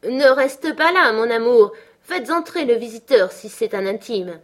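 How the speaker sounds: lively cry. A narrator's reading. Husband calling down to his wife from the window, crying out briskly. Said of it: "car il s'écria vivement".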